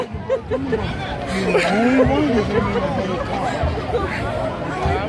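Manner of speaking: quiet wheezing laugh; soft laugh. Real, not acted. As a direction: laugh softly.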